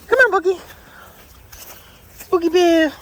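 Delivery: very high, sing-song call; calling the dog. Real, not acted. Calling her dog by pet name.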